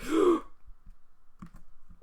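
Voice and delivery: sharp, high gasp of alarm; real sudden intake of breath; startled gasp. Real, not acted. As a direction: gasp as if nearly falling. Startled by near-fatal risky jump.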